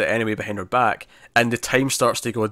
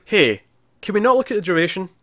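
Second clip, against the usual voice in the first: lightly voiced woman; 8 semitones higher than usual. She pleads not to check timer.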